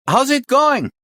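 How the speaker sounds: high, loud shouted question; shouting. Acted. Chief asking for progress on a bad line, shouting. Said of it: "Stop shouting!".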